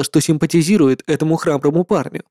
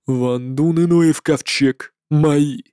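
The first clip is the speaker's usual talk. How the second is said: slow, cold, proud inhuman voice; eldritch horror; 3 semitones lower, much slower than usual. Acted. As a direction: contempt for all living. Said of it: "Холодный и бесконечно гордый".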